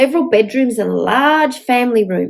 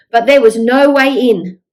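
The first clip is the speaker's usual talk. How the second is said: ordinary delivery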